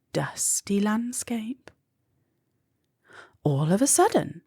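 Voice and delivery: plain reading voice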